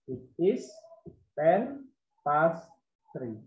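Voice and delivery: ten past three drawn out word by word; very slow model. Shown as a demonstration. Teacher shows the class how to add past, very slowly. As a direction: stretch it out so pupils hear every word. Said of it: "kita tambahkan kata past".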